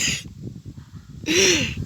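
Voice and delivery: high, wheezy burst of laughter; real squeaky laugh; sudden laugh. Real, not acted. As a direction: crack up at your own slip. Laughs at own wording.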